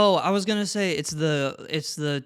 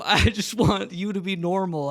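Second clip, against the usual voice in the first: laughing; 4 semitones higher, 4 dB louder than usual. Real, not acted. Giggling fit continues.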